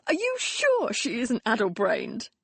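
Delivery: laughing through the words; mock him through a laugh. A narrator's reading. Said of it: "Grant burst out laughing".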